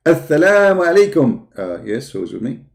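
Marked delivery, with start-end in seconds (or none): 0.1-1.5 higher, louder greeting as the caller; mock Dawah caller. Streamer playing the Dawah team calling in, mock greeting. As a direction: put on the caller's voice.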